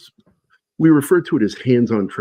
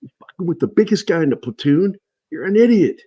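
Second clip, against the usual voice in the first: retold drill sergeant yell; 7 semitones higher than usual. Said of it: "I hear him yelling at Jones".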